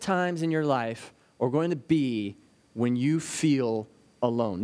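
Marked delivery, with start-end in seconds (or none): 2.7-4.6 "feel alone" drawn out slow and lower; stress on feel alone. Shown as a demonstration. Preacher stressing to the church that you "feel alone", slow and low. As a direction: lean slowly into "feel alone". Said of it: "Notice I emphasize feel alone".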